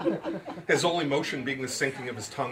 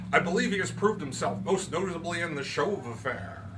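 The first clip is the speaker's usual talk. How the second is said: Root's voice; 8 semitones higher than usual. A narrator's reading. Root lectures his guest on the magic word, higher and grand. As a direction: pronounce it like a showman, slowing on the magic word.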